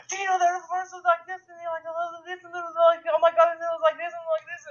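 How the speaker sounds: mock the kid rattling off dinosaur facts; excited know-it-all kid. Mocking the excited little brother, high gushing kid voice. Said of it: "overly exaggerate his character of being so excited".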